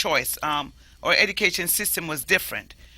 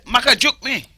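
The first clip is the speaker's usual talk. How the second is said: Maka juke me higher and louder, abrupt; abrupt Jamaican Patois; 7 semitones higher, 8 dB louder than usual. Shown as a demonstration. Contrasting English with abrupt Patois, Jamaican accent. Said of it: "But a Jamaican say".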